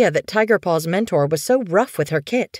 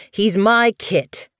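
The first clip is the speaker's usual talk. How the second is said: higher, slow, possessive comeback; sharp retort; 5 semitones higher, slower than usual. Acted. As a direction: retort firmly, stretching each word. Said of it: "he retorted".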